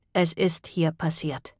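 slow, soft and hollow, like at a funeral; grave voice. A narrator's reading. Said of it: "sagte Beth mit Grabesstimme".

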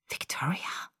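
hissed, voiceless question; very soft, whispered hiss. A narrator's reading. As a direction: hiss the name under your breath. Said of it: "zischte sie".

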